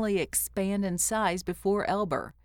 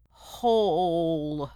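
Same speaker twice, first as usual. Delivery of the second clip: gurgling creature; 3 dB louder than usual. A narrator's reading. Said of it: "gurgled the creature".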